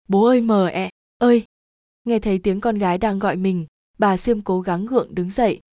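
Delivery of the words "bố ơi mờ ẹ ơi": feebly voiced call of a sick girl; weak feeble call. A narrator's reading. Daughter coming round calls her parents, weak and halting. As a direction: call out weakly, word by word. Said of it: "nó thều thào nói yếu ớt".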